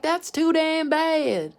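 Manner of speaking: loud, high, drawn-out retort; hammed-up grandpa shutting down a complaint; mock stern grandpa. Acted grandpa snapping back at granddaughter.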